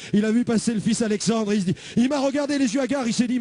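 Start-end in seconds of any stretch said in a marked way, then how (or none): none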